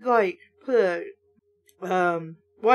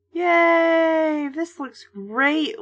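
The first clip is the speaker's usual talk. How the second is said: excited; 5 semitones higher, much slower than usual. A narrator's reading. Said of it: "Luke exclaimed".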